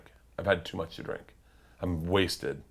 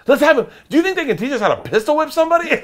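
gleeful quote; 5 dB louder, faster than usual. Retold or imitated. Quoting goofy Rachel's drunk idea.

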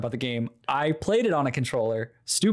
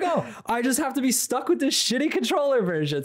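high, laughing, defeated complaint; laughing resignation; 11 semitones higher than usual. Retold or imitated. Stuck with controller version, high laughing grumble. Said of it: "I was like".

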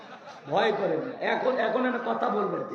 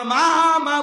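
louder, higher repeated call of mother; 6 semitones higher, 5 dB louder, much slower than usual. Acted. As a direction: call through the closed door. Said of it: "বলে ডাকলেন".

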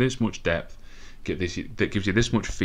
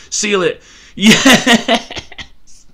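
much higher, much louder triumphant yell; ecstatic shout; 13 semitones higher, 12 dB louder, much slower than usual. Real, not acted. Fan celebrating the winning goal, yelling with joy. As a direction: scream as the goal goes in.

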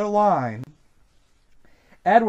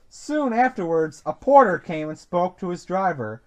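ordinary delivery, nothing marked

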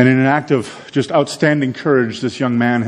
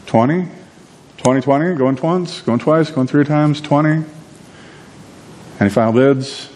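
higher, quick auction patter; auctioneer's call; 5 semitones higher than usual. Retold or imitated. Auctioneer pushing for a higher bid, higher-pitched patter. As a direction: rattle through going once, going twice.

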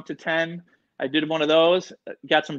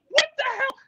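very high-pitched mock alarm; freaked out. Guest finding sweat before the show, freaked-out high voice. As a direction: squeal it in retold disbelief. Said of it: "like, freaked out".

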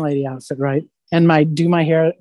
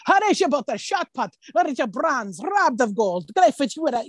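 much higher mock foreign accent in broken English; 7 semitones higher than usual. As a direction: play the foreign shot-putter mangling English. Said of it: "I would throw in some English".

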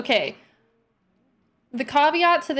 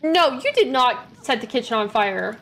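normal talking voice

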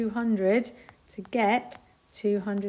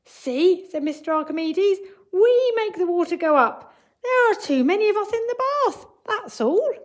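excited explanation; 9 semitones higher, 6 dB louder than usual. A narrator's reading. Archimedes explains, still excited.

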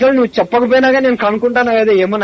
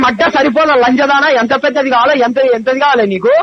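furious shouting; 4 semitones higher than usual. Real, not acted. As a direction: shout the insults, real fury. Furious insults in phone fight. (కోపంతో గట్టిగా అరుస్తూ)

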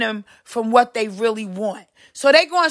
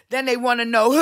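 normal talking voice

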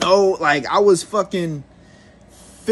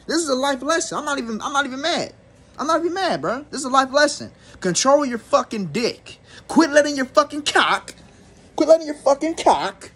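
higher, preaching at the camera; mild sermon to the viewers; preachy life lesson; 7 semitones higher than usual. Real, not acted. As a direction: lay down the law like a street preacher.

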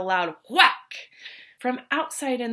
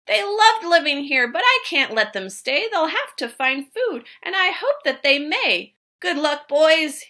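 raised, louder voice with a high lift; crying out; 5 semitones higher, 6 dB louder than usual. A narrator's reading. Sending the barbaloots away, calling out louder. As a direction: call out the goodbye to the barbaloots. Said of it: "he cried".

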